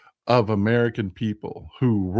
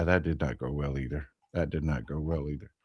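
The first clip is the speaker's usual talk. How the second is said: much softer, under the breath; muttered aside; 8 semitones lower, 10 dB quieter than usual. Real, not acted. Muttering about Mike Johnson, softly under the breath. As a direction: drop it to a mutter.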